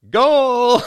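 very high, loud mock goal shout; sportscaster goal cry. Imitating a South American soccer sportscaster, long goal cry. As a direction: stretch it out at the top of your voice. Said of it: "Central and South American sportscasters".

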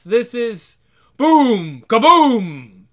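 slow booming blast noise; voiced blast sound; voiced explosion.

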